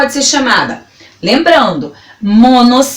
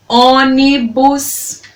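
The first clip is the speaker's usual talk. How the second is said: ÔNIBUS slowly drawn out as ô-ni-bus; syllable by syllable; much slower than usual. Shown as a demonstration. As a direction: stretch each syllable of ÔNIBUS. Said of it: "falando elas pausadamente pra você".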